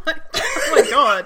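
loud, high laughing exclamation; laughing disbelief. Real, not acted. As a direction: cry out through real laughter. Laughing relief after scare.

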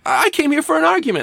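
very high, hammed-up complaint; mock indignant protest. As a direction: squeal it like an affronted sketch customer. Acting yelled-at customer in sketch.

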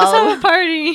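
mock-cheery Bella. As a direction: ham up the fake cheer. Joking mock Bella lines.

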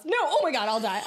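disgusted outburst. Real, not acted. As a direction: shriek in real disgust. Grossed out by the gum.

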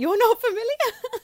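high, loud amazed question; astonished. Real, not acted. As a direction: gasp it out in disbelief.

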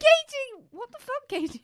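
high loud outraged cry; shocked shout. Retold or imitated. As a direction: yell her name in disbelief. Confronting friend retold.

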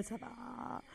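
soft unvoiced aside; whispered aside. Real, not acted. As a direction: breathe it out as an aside. Deadpan aside. (chuchoté en aparté)

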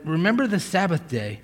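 ordinary delivery

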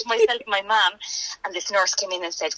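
usual speaking voice

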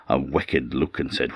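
plain reading voice

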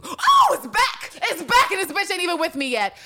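high, loud excited outburst; thrilled shout. Retold or imitated. Host voicing Villanelle's feelings returning, thrilled. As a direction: blurt it out, overjoyed.

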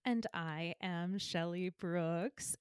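low and deliberate self-introduction; drawn-out hushed name reveal; slow, low dramatic intro. Acted. Co-host copies dramatic intro.

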